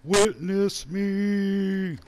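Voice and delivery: high, loud yell, long held last word; hammed-up war cry, stretched right out; mock battle cry. Player charging after a witness, hammed-up battle cry.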